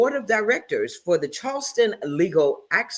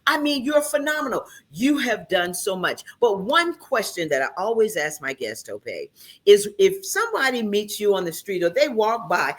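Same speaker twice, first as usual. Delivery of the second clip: ordinary talk, nothing marked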